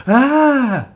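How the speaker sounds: loud, very high scream; startled yell. A narrator's reading. Father turns round to a painted face behind him, startled yell. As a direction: scream as if someone just jumped out at you. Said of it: "His father yelled".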